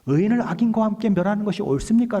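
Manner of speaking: high, loud acted protest; 따지는 challenge. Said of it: "라고 따지는 거예요". (높고 크게 따져 묻는 말투)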